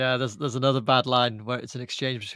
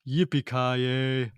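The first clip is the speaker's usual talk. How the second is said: Bruce Willis drawl; much slower than usual. Retold or imitated. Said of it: "and Bruce Willis".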